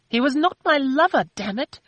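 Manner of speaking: high, loud furious denial; angry snap. A narrator's reading. Christina snapping at Philip, high loud angry denial. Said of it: "Christina snapped angrily".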